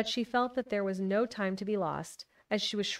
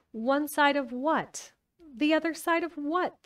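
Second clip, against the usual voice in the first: puzzled; 7 semitones higher, 4 dB louder, slower than usual. Acted. Alice puzzled to herself.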